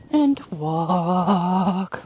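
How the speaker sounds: WALK very long and low; downward transition command. Shown as a demonstration. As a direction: drop the voice and stretch "walk". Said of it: "my voice goes down".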